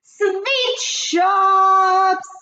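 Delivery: high sing-song shout; shouting witch. Acted. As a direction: announce it grandly. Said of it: "shouted the Grand High Witch".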